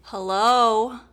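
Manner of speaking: loud incredulous hello; exasperated loud hello. Retold or imitated. Jesus exasperated that she hasn't caught on, loud. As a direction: call it out like she missed the obvious. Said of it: "he's like".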